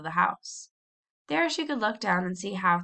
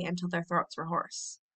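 ordinary delivery

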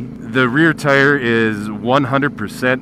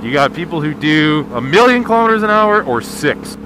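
ordinary talk

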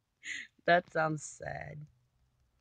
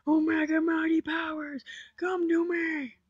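over-the-top battle cry; mock epic invocation; 12 semitones higher, 4 dB louder than usual. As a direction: summon power like a cartoon hero. Mock invocation while eating gapple.

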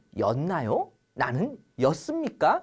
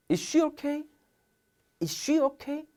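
a light, much higher character voice; lightly voiced anxious questioner; worried asker; 11 semitones higher, much slower than usual. Teacher playing the one who asks about her, high and light.

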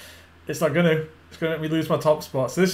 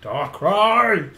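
excited shout; 4 semitones higher, 6 dB louder than usual. Real, not acted. Excited card reveal call.

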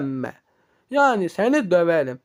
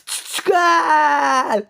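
high, tearful yelp; sobbing high plea; crying cry; 7 semitones higher than usual. Acted. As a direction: cry it out in panic. Character cries out.

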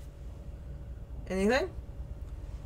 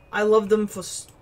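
ordinary delivery

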